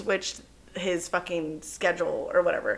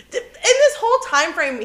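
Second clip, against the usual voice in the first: ordinary delivery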